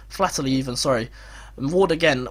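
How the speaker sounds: usual speaking voice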